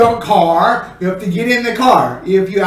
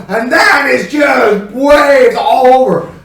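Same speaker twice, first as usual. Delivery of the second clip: louder, dramatic emphasis; emphatic storm build-up; 3 semitones higher, 5 dB louder than usual. Shown as a demonstration. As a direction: act out waves crashing everywhere. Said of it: "these here are more on the emphasis".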